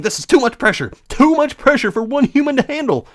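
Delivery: high, strained whine; high whining. Acted. Child complaining of too much pressure, whining high. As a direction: whine it like an overwhelmed kid.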